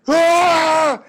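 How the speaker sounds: high, loud mock scream; mock yell. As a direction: screech like a hothead.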